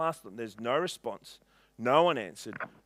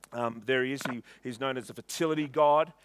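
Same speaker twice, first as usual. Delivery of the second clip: usual speaking voice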